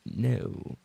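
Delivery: ordinary talk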